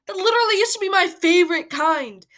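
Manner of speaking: normal talking voice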